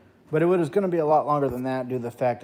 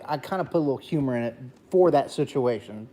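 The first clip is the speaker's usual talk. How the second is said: ordinary talk, nothing marked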